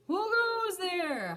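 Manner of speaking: high, slow holler into space. A narrator's reading. Kitty boy calling to a comet in orbit, hollering. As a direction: call out loud to the passing comet. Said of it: "hollered Scapito".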